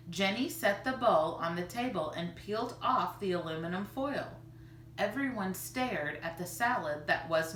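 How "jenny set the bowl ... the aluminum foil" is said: plain reading voice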